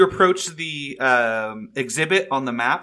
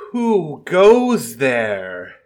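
slow, booming call from the dark; dragon voice; 3 semitones higher, 9 dB louder, much slower than usual. Acted. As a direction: boom it out slowly like a huge dragon. Dragon calls from the dark cave.

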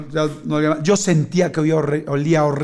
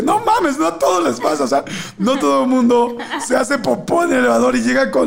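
high-pitched, comic indignant outburst; incredulous protest; 6 semitones higher than usual. Retold or imitated. Embarrassed client refuses to be consoled, high acted incredulous protest. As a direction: protest in disbelief, voice shooting up.

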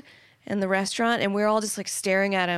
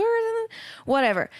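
incredulous; 13 semitones higher than usual. Retold or imitated. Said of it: "And I was like".

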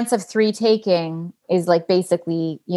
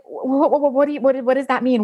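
stammering confusion; 5 semitones higher, faster than usual. Retold or imitated. Stunned by doctor's news.

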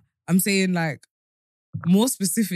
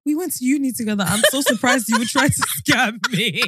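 high, laughing through the words; laughing retold quote; 7 semitones higher, 3 dB louder than usual. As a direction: quote her while cracking up. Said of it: "You know, I said to her that".

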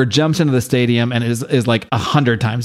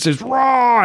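mock monster scream; 11 semitones higher than usual. Imitating the bitten ogre, mock scream. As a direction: draw out a hammed-up "raw" as a roar. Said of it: "This thing just screams".

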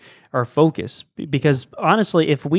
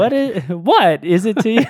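higher and louder, oddly stressed what; Christopher Walken impression; 6 semitones higher, 4 dB louder than usual. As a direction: play with the stress like a quirky actor. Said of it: "That's the Christopher Walken impression".

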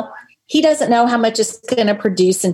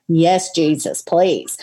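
ordinary talk

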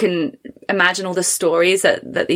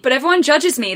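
ordinary talk, nothing marked

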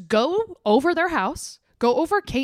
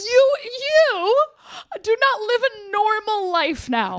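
higher, near-crying exasperation; exasperated wail; 6 semitones higher than usual. Real, not acted. Ranting at the couple, a near-crying wail. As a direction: wail it in disbelief.

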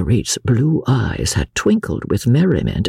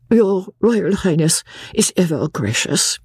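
dignified; 8 semitones higher than usual. A narrator's reading. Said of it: "with becoming dignity".

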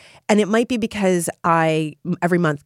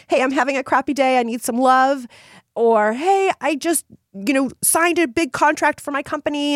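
lightly voiced excited group member, higher; chirpy member sharing news, pitched up; imagined member post; 6 semitones higher than usual. Example posts from group members.

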